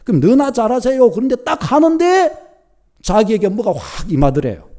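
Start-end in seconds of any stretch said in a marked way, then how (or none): 0.2-1.1 "너나 잘하세요" thrown out louder and higher as a mocking jab; scornful retort. Scoffer retorting at the TV preacher, loud mocking jab. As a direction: snap back "너나 잘하세요" with scorn, higher pitched. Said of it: "자기 안에서 막 그러대요".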